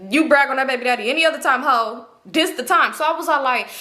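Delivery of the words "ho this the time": ordinary talk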